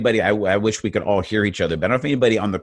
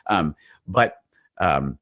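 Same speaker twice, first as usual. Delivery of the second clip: ordinary talk, nothing marked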